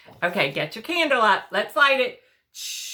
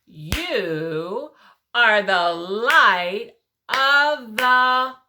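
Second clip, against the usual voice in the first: clapped recitation; 3 dB louder, much slower than usual. Shown as a demonstration. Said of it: "can we clap it".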